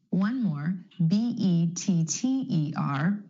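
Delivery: ordinary talk, nothing marked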